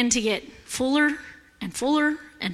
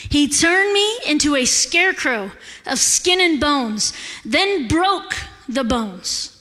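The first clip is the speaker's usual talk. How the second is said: ordinary talk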